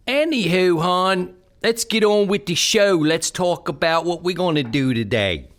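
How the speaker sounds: perky Baltimore hon. Pretend.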